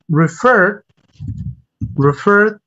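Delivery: "refer" slowly, as "re-FER"; second-syllable stress. Shown as a demonstration. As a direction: lean on the last syllable of "refer". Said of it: "the second syllable is stressed".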